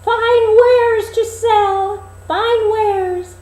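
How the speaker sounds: put-on very high, loud, slow old peddler call; a disguised queen hawking like an old crone; peddler woman crying wares. Queen as old peddler calling at the dwarfs' door. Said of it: "knocked at the door and cried".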